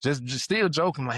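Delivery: ordinary delivery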